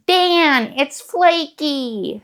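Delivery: high, drawn-out wail on FLAKY; mock outcry. Calling to Dan that it's flaky, high drawn-out cry.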